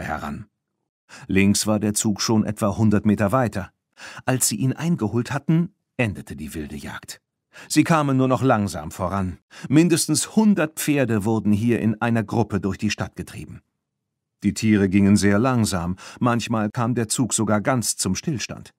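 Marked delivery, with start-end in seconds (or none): none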